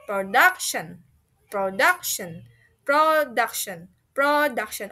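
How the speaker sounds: "production" with DUC stressed; stress on middle syllable. Shown as a demonstration. Teacher drills the class, "production" stressed on DUC. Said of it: "in the middle of the word".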